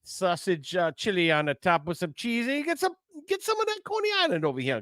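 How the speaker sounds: high-pitched, put-on Jersey accent; mock New Jersey accent. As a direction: hawk food in a hammed-up Jersey accent. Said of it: "It's supposed to be New Jersey".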